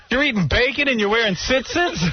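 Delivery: high, incredulous outburst; scandalized aunt. Retold or imitated. Aunt catching her nephew eating bacon, scandalized.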